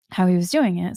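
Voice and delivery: ordinary delivery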